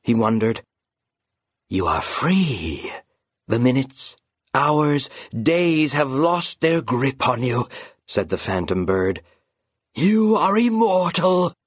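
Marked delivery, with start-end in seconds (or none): 1.7-7.7 slow, coaxing, eerie whisper-like voice; sly phantom bird. Acted. Phantom bird luring the raven into a bite, slow and sly. 9.9-11.5 slow, eerie proclamation, rising high; triumphant phantom bird. Acted. Phantom bird proclaiming the raven immortal, slow and eerie.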